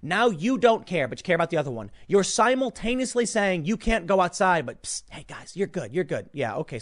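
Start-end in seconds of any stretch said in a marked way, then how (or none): 4.6-6.3 hushed secretive aside; psst for attention. Retold or imitated. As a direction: whisper it like a secret. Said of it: "secret wink to protesters".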